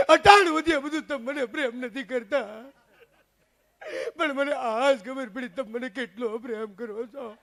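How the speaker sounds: hammed-up tearful aunt; weepy comic lament; mock crying. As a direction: complain through fake sobs. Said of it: "મારી કાકી રોવે".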